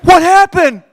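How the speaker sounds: very high, very loud horrified cry; freaking-out shriek. Retold or imitated. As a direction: shriek it like a panicked partner. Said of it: "She was, like, freaking out".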